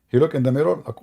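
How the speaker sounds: ordinary talk, nothing marked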